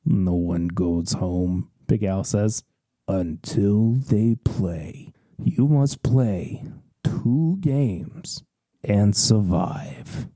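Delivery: low, very slow threat; ominous carnival host. Acted. Big Al tells the player no one leaves, low and slow. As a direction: growl it out like a sinister barker.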